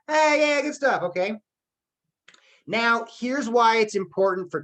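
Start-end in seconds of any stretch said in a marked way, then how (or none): 0.1-1.3 pitched-up, louder cheer; teacher showing off high energy to the class. Shown as a demonstration. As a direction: pump up the class. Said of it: "so that's my energy".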